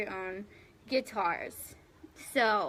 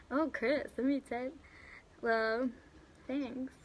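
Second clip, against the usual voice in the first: ordinary talk, nothing marked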